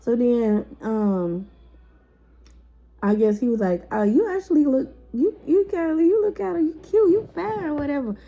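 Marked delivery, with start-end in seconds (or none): 3.9-8.2 mock flirty high voice. Guy flirting in retold story.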